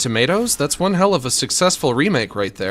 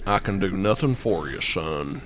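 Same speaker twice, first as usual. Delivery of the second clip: gruff lawman voice; 7 semitones lower, 6 dB quieter than usual. Retold or imitated. Streamer quoting the movie line to chat, low gruff impression. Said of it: "that voice".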